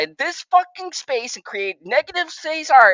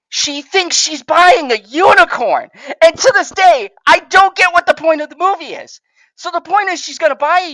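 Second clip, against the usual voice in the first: high, indignant exclaiming; exasperated rant; 4 semitones higher than usual. Real, not acted. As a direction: rant in raised-pitch disbelief. Exasperated rant about the movie.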